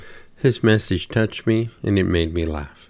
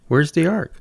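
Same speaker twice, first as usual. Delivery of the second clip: mild narrated shout; 6 semitones higher than usual. A narrator's reading. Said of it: "he shouted".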